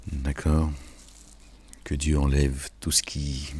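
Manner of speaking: ordinary talk, nothing marked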